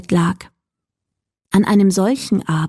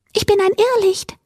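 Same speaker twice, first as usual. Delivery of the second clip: quick, very high creature voice; will-o'-the-wisp; 13 semitones higher, faster than usual. A narrator's reading. Little light introducing itself to Mila, high creature voice. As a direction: pipe it up high and fast. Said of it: "antwortete das Irrlicht".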